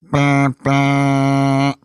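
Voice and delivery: imitated two-blast train horn. Distant train heard on the hike, imitated horn blasts. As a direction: honk two long level notes like a train. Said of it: "she heard in the distance".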